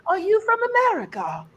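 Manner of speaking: put-on very high, excited British kid voice; awestruck British child. British kid comes up to visitor, awestruck high voice. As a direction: squeal it in a British accent as if you'd won a million. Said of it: "the way they say it is just amazing".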